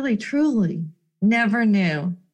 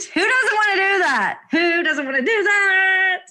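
excited rhetorical question; 9 semitones higher than usual. Real, not acted.

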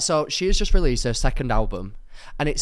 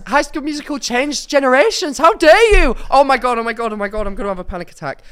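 loud, higher hammed-up outrage; mock meltdown; 10 semitones higher, 9 dB louder than usual. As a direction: spiral into fake panic, fast at the end. Mock meltdown over musical.

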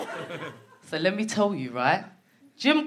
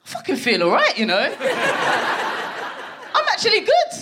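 tipsy giggly boast; 7 semitones higher than usual. Retold or imitated. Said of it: "you think".

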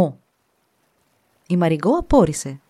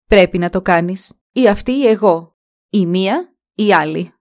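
loud, pressing demand, slowing at the end; insistent stepmother; 6 dB louder than usual. Acted. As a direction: hammer home the ultimatum. Stepmother insists, either her or me.

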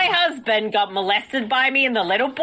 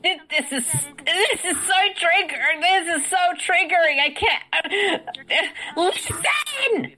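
triggered character; 5 semitones higher than usual. Acted. Melanie character triggered outburst.

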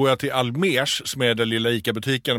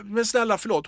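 högt tonläge, återberättad bön om förlåtelse; ropad vädjan; 8 semitones higher than usual. Retold or imitated. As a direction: spela upp ett bedjande utrop utan att skrika. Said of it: "Och jag bara ropar". (härmat vädjande rop)

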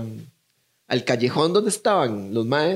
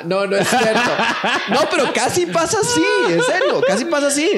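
laughing through it, high and louder; 11 semitones higher, 4 dB louder than usual. Real, not acted. Teller admitting his story was invented, laughing. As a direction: giggle out the confession.

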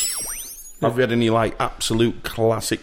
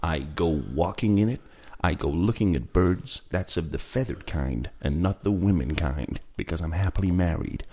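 low, soft mock footballer voice; Johnny Hawks impression; 4 semitones lower, 5 dB quieter than usual. Presenter to cohost, low and soft mock footballer. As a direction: lower and softer, a smug footballer musing. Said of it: "Would it help if I did an impression?".

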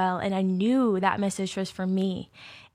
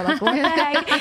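much higher and louder, breaking into laughter; laughing disbelief; 7 semitones higher, 6 dB louder than usual. Retold or imitated. Falling to the floor in deliverance, laughing in disbelief. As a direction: burst out laughing at it.

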